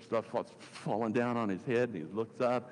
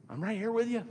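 higher, normal-paced reassurance; bright-pitched reassurance; 9 semitones higher, faster than usual. Retold or imitated. Preacher voices Jesus to the discouraged, higher reassurance.

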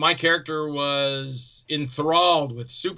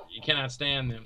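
ordinary talk

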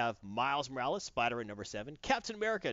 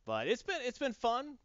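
ordinary talk

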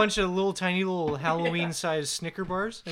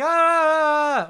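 short loud yell, higher; pitchman yell; 9 semitones higher, 8 dB louder than usual. Retold or imitated. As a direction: bark it like a shouting ad host. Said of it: "Or they're like".

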